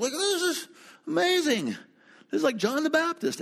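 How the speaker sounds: high, excited awe; amazed. Retold or imitated. Pastor to his companions watching the preacher, high excited wonder. Awed remark to Joanne and Pat.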